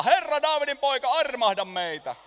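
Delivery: high, loud plea; crying out. Acted. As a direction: call out to Jesus for mercy. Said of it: "he alkoivat huutaa".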